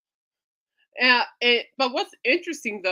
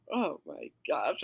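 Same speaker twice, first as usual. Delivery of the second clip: quiet groan of dismay; 9 semitones lower, 9 dB quieter, slower than usual. Retold or imitated. Retelling nausea reaction.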